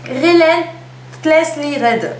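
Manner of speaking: "Relentlessly Red" pronounced very slowly, pitched high; "Relentlessly Red" drawn out with great care, as a tricky foreign mouthful; tongue-twister name. Shown as a demonstration. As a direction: labour through "Relentlessly Red" like an unpronounceable name. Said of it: "personne ne prononce ça".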